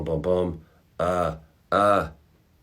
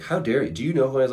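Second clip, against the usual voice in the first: offended; 6 semitones higher, 3 dB louder, faster than usual. Retold or imitated. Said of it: "Michelangelo was offended".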